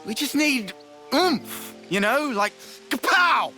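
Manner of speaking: high, eager burst into a punchy kapow; spoken sound effects. Acted. Explaining the needed power to friend, excited sound effects. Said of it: "Sound effects not help comprehension".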